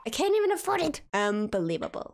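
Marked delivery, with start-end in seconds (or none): 0.1-1.0 I can't EVEN afford it, pitched high; squeaky outraged repeat; exasperated high repeat. Real, not acted. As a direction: repeat it higher in disbelief.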